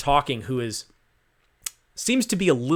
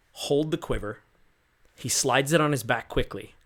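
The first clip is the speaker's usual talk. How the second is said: normal talking voice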